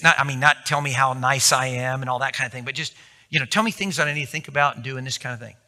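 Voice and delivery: normal talking voice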